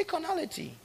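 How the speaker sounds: high call-and-response. Shown as a demonstration. Pastor leading the congregation, high call. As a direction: lift the word high for the echo.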